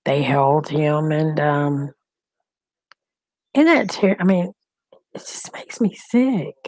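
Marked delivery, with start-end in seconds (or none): 5.1-6.6 hushed, breathy "sick" trailing off; whispered disgust. Real, not acted. To her viewers, a disgusted whisper. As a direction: whisper it like you can't stomach it. Disgusted aside about an arrest.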